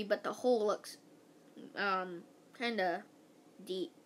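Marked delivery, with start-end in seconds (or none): none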